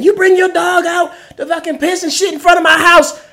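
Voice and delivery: high shouted accusation; imitating her screaming. Man mimicking the solicitor screaming about his dog. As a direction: scream it like the angry woman. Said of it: "she's still going on screaming".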